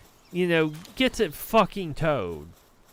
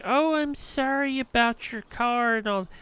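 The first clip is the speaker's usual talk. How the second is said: high-pitched sugary landlady apology; fake-nice landlady; 7 semitones higher than usual. Landlady apologizing to tenant over towed car, mock-nice. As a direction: mimic her apologizing nicely, pitched high. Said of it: "talks all nice to me".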